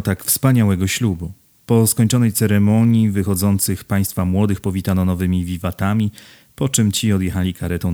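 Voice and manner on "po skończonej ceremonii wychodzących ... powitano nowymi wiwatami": usual speaking voice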